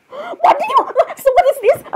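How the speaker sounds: very high squealed protest; worked-up outrage. Acted. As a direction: squeal it as if losing your cool. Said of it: "c-, calm down".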